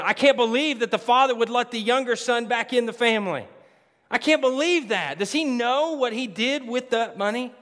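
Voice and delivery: high, loud, outraged "I can't believe that"; indignant disbelief. Retold or imitated. Elder brother outraged at the father taking the son back, loud.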